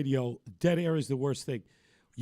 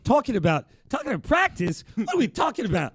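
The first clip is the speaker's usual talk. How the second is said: high-pitched incredulous rant building to a shout; exasperated athlete impression, stammering up into a loud squeal; Allen Iverson; 13 semitones higher, 4 dB louder than usual. Host mimics star's practice press conference, incredulous impression. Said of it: "pretty good Allen Iverson impression".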